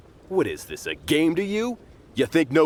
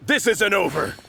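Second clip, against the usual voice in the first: higher, sharp threat; parting threat; 9 semitones higher than usual. Acted. Beaten thug warns the swordsman, higher and sharp. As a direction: spit it back while retreating.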